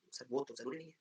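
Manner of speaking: a soft, small student reply; timid student. Acted. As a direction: answer meekly as the pupil. Student voice answering. (धीमी आवाज़ में छात्र का जवाब)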